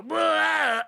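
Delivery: a sudden high, loud bark of a groan; a starving tiger's weak roar; tiger's weak growl. Acted. Caged skinny tiger moaning, a high loud groan. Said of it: "every now and again it would go".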